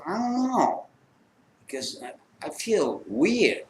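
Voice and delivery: softly imitating a drugged buddy; dazed friend. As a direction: sound woozy and lost. Drugged friend sounds strange.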